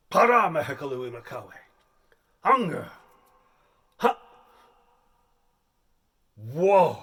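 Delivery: booming primitive grunts of hunger; loud caveman. Acted. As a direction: bellow like a starving chieftain. Caveman audition performance.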